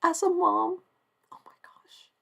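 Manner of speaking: soft, high, partly whispered oh my gosh; choked up with emotion; moved hush. Real, not acted. Moved by Muniba as a mom.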